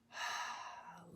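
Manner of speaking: breathy, audible exhale; letting-go sigh. Shown as a demonstration. Teacher demonstrating a soothing breath, relieved sigh. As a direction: breathe out and release. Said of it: "letting go".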